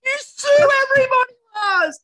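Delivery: high, loud comic outcry; mock accusing shout. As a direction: holler the accusation at the top of your range. Mock accusation of absent musician.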